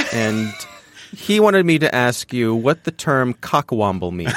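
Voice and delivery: usual speaking voice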